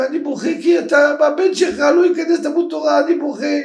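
high-pitched mock crying lament; imitated tearful plea. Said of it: "הרב, למה אתה בוכה?". (חיקוי של תחינה בוכייה)

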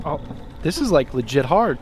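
normal talking voice

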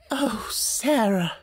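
high, slow whimper; almost cry the name. A narrator's reading. Worn-out teacher begging Sarah for rescue, whimpering. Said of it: "almost whimpered Miss Amelia".

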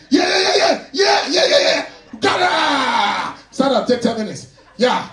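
loud high gym-coach yells; trainer shouting. Retold or imitated. Gym trainer shouting his client through reps. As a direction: pump them up, full volume. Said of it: "trainer hyping client".

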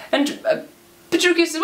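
ordinary delivery, nothing marked

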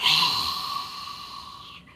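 long, forceful dragon exhale; big audible whooshing exhale; fire breath out. Shown as a demonstration. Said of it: "Fire breath out".

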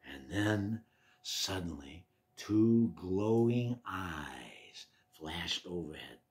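low, slow near-whisper; hushed suspense. A narrator's reading. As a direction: creep up on the scare in a hush. Suspense before the scare.

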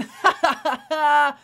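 loud, high laugh at himself; burst of laughter. Real, not acted. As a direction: laugh at your own mistake. Laughs at own slip.

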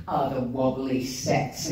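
low, dismissive scoffing voice; mocking the scoffers. Mocking the Doctor Who doubters in a low scoff. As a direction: sneer it like a bored sceptic. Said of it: "Albert, you know".

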